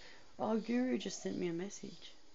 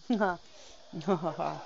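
slow evil cackle; villainous mwahaha, drawn out; mad scientist; 6 dB louder, much slower than usual. Acted. Bragging she can cook.

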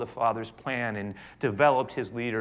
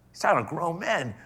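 usual speaking voice

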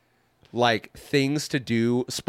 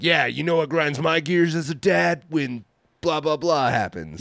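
goofy mock listener voice; chat voice; 5 semitones higher than usual. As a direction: voice a chatter griping. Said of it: "the Twitch chat is jumping in on that and being like".

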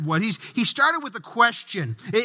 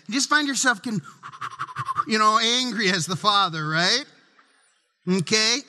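ordinary talk, nothing marked

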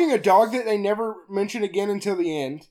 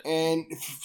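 normal talking voice

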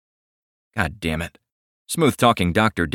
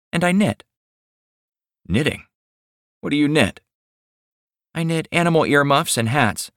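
higher, sweet shy woman's voice; shy young woman; 7 semitones higher than usual. Acted. Millie speaking, higher voice.

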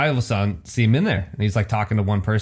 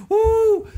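mock woo; 3 dB louder than usual.

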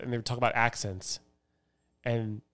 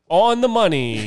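loud, drawn-out, raised-pitch endorsement; emphatic mock agreement; 5 semitones higher, 8 dB louder, slower than usual. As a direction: declare it proudly, stretching the words. Mock proud agreement with insult.

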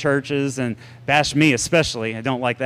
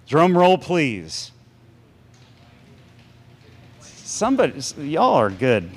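normal talking voice

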